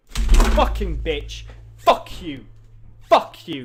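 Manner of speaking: loud angry outburst; furious cursing. Real, not acted. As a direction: spit the curses out loudly. Cursing an unwanted game result.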